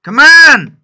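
very loud, high rally cry; pumping up the squad before kickoff, full shout; team talk shout. Real, not acted. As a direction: roar at the players in the locker room. Cheering a chance.

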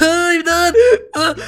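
panicked high cry for help; scared. Real, not acted.

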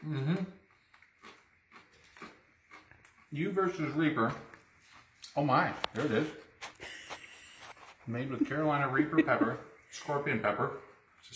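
words around a chip; talking while chewing. Real, not acted. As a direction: mouth partly full. Seen 0: Ate chip at -4s; jaw and closed lips move while speaking.

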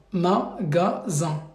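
Magasin drawn out very slowly, syllable by syllable; slow model word. Shown as a demonstration. Teacher dictates Magasin slowly to the student. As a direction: stretch out Magasin slowly so they can read it. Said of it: "pour le deuxième mot".

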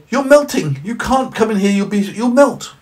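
put-on high, wide-eyed little boy. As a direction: ask it like a curious small child. Johnny alarmed.

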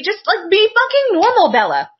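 fed up, throwing it at the character; exasperated outburst. Real, not acted. Friend ranting to friend about Bella, exasperated. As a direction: pitch jumps up, pleading with her to be normal.

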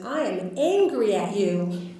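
slow, emphatic imitation of an angry patient; retold anger. Patient confronting her doctor, angry. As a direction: draw out the accusation, every word weighted. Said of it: "why are you angry".

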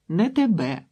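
put-on high, loud little-girl retort; contradicting child. A narrator's reading. Girl contradicts her boasting sister, childish retort.

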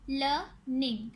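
"Learning" slowly split into two beats; "learning" with a gap between syllables. Shown as a demonstration. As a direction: say "learning" as लर, pause, Ning. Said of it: "टू syllable words".

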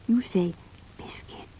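"biscuit" whispered; whispered prompt. Shown as a demonstration. Prompting children to say the word, whispered. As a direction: breathe "biscuit" so the children fill it in. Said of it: "And you say them this time".

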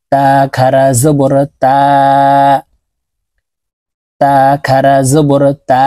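তা drawn out a full alif, light and thin; slow drill recitation. Shown as a demonstration. Teacher showing viewers the long thin তা, slowly. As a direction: stretch তা long and keep it thin. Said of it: "তো আমরা এইভাবে পড়ব".

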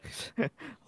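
high cartoonish giggle; a quick high giggle; cartoon laugh. Real, not acted. Laughs at the question.

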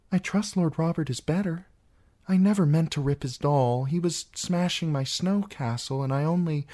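put-on a girl rushing to explain herself; a niece defending herself; young Sansa pleading.

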